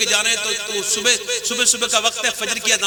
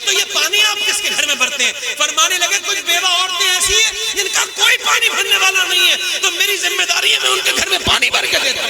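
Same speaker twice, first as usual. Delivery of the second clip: preaching at full force; 9 semitones higher than usual. Acted. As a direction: belt it out to the whole congregation. Preacher voicing Umar at full force.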